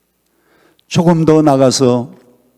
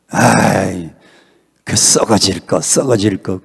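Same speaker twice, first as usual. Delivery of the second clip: lightly imitated Honam grumble, lower; Honam dialect; 4 semitones lower than usual. Preacher imitating his fellow Honam people, light Honam dialect. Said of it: "우리 호남 사람들은요".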